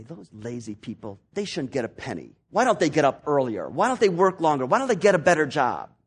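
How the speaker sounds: sneer, then work up to indignation; scornful complaint. Retold or imitated. Hardworking man looking down on lazy people, building scorn. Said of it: "look down at lazy people and say".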